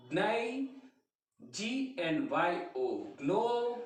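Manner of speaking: slow, each letter spelled then sounded out; deliberate letter-by-letter drill for students; slow spelling drill. Shown as a demonstration. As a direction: spell and sound out every syllable like a teacher. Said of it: "तो यहाँ पे देखिए".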